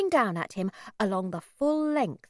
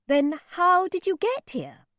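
suspicious; 9 semitones higher, 5 dB louder than usual. A narrator's reading. Mother cross-examines.

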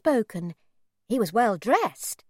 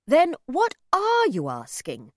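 impatient; 5 semitones higher, 6 dB louder than usual. A narrator's reading. Impatient mother demands.

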